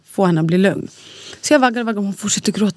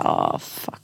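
whispered, much softer sigh of a curse; exasperated whisper; 8 dB quieter than usual. Real, not acted. Mother recalling her exhaustion, whispering the curse. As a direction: let it fade into a whisper.

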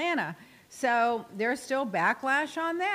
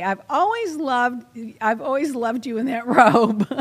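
louder, laughing through robe; laughing mock compliment; 7 dB louder than usual. Host acting a mock compliment on a robe, laughing. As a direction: gush over the robe, laughing.

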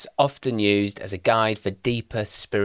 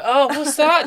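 high, falsely innocent question; mock innocent; 6 dB louder, slower than usual. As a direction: pretend you found something odd. Feigned innocence wiping bogey.

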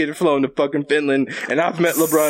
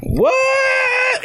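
high, shouted, drawn-out "What?"; a stunned yell of disbelief; shocked "what". Retold or imitated. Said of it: "And I'm like".

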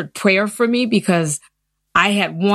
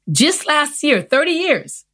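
"just last year, thirty years" pitched much higher, each phrase punched; emphatic disbelief; 9 semitones higher than usual. Real, not acted. Athlete stressing to the interviewer how long it took, emphatic.

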